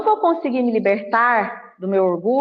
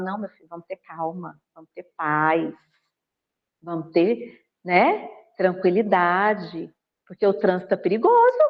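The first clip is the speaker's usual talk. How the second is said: calming voice; 5 semitones lower than usual. Retold or imitated. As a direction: settle the son down gently. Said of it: "eu falo".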